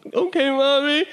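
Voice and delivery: high, put-on childish voice; mock-tearful obedient kid; whimpering little child. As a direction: answer in a high, whiny baby voice. Tearful son answering mom.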